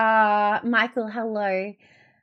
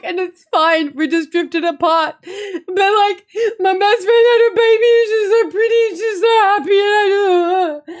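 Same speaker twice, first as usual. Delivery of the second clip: sobbing explanation; 11 semitones higher, 11 dB louder than usual. Retold or imitated. Explaining the drifted friendship to the girls while sobbing. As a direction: pour it out while crying.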